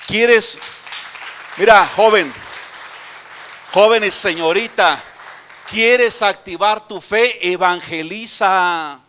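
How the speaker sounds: put-on loud, slow preaching call to the young; preaching at full force. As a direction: urge the youth loudly, almost shouting. Preacher calls out to the youth. (predicación a todo pulmón, llamando a los jóvenes)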